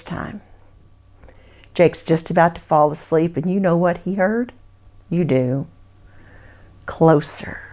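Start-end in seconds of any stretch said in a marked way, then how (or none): 5.1-7.6 whisper. A narrator's reading. Narrator whispers suspense.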